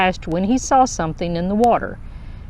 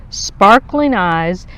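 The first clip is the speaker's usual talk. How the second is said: ordinary delivery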